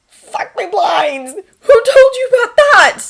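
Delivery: loud, high, drawn-out exclamation; shocked outburst. A narrator's reading. Thomas learns his secret is known, shocked. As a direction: cry out in alarm and demand an answer.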